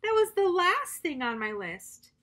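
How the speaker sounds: high, loud happy exclaim; bright storybook excitement; excited. Acted. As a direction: Toad delighted it's the end. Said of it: "shouted Toad".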